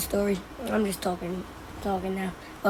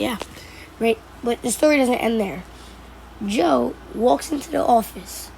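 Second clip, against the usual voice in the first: plain reading voice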